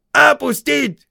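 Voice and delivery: high, loud barked order; shouting drill sergeant. A narrator's reading. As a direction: bark the drill command. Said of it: "крикнул Хейксвилл". (строевая команда)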